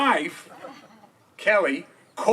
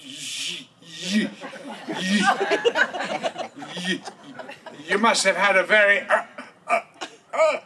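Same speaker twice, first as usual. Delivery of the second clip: stammering; 3 semitones higher than usual. Retold or imitated. Tag stuttering a comeback at the college guy, laughing. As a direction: stammer it out, then land the insult. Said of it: "He goes".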